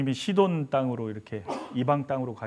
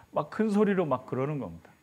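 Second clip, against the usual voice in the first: normal talking voice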